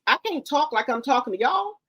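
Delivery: ordinary talk, nothing marked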